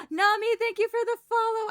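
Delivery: high, loud, fast thanks; excited. Real, not acted. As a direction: burst out with thanks.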